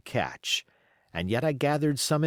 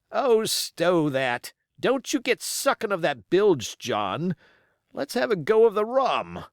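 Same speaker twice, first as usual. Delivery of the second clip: higher, louder pirate's protest; exclaimed; 6 semitones higher, 4 dB louder than usual. A narrator's reading. Hans protests to Silver, higher louder exclamation. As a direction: push away the apple, demand rum. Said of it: "the voice of Hans exclaimed".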